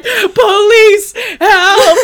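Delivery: high, loud, stretched-out comic wail; mock cry for help. [name] calling the police, mock panic. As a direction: call out in hammed-up panic through laughter.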